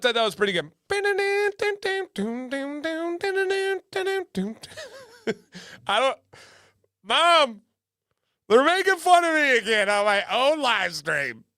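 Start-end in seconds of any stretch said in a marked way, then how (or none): 0.9-5.0 high sung "dun-dun" melody; mouthed instrumental riff. Pretend. Imitating a tune after joking. 5.9-11.4 high, loud tattling to mom; mock whiny child. Acting teased child complaining to mom.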